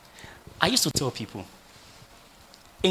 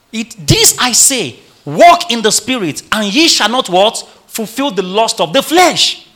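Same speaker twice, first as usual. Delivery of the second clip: loud, raised preaching voice; forceful scripture reading; 5 semitones higher, 9 dB louder than usual. Real, not acted. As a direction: proclaim the verse at full force. Reads verse aloud to congregation.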